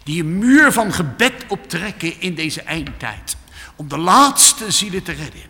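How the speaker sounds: loud, high preaching that builds up; fiery preaching. Acted. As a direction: urge the congregation with full force. Preacher exhorting at full force.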